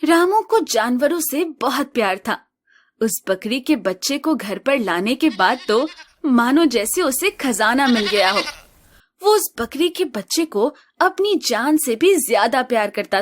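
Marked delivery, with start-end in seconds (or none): none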